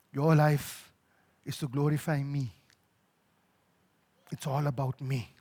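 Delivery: low, measured voice with long pauses; God speaking gently. Retold or imitated. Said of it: "then I hear the Lord say".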